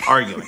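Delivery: "Arguing" lightly mangled, amused; mimicked mispronunciation. Co-host corrects which word he meant, mimicked mispronunciation. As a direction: echo the botched "Arguing" with a laugh. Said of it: "he can pronounce disagreements better than arguing".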